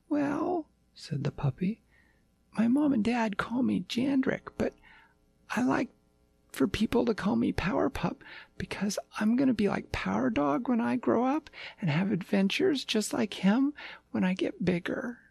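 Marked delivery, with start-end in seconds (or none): 0.1-0.7 high, eager little puppy; a lost small pup, chirpy and sweet; yappy panting puppy. Acted. 2.6-15.2 high, eager little puppy; a lost small pup, chirpy and sweet; yappy panting puppy. Acted.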